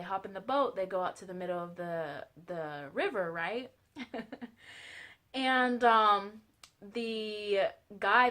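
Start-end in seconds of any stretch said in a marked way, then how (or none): 4.0-4.5 short soft chuckle; small laugh at her own story. Real, not acted. Storyteller amused by the river joke, soft chuckle.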